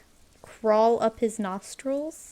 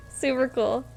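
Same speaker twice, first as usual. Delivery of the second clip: usual speaking voice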